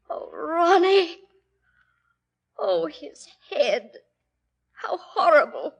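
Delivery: slow, hushed horror; stricken grief. Acted. Sister finding her dead brother, slow stricken grief. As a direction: breathe it out in shock.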